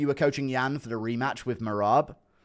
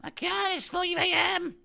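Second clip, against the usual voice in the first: Leon impression; slower than usual. Leon's reply to the speech.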